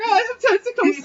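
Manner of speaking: very high, squeaky comic freak-out; squealing halfling. Acted. Halfling discovers he has tentacles, high mock squeal.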